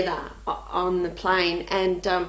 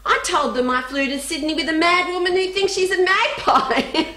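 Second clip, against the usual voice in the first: put-on higher child's report, laughter creeping in; little girl; 7 semitones higher than usual. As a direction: imitate a child's matter-of-fact punchline. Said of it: "She said".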